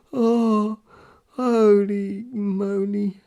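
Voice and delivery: high, loud, stretched-out recovery from laughing; slow, high "holy moly"; drawn-out exclamation. Real, not acted. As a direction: let out a long high groan after giggles.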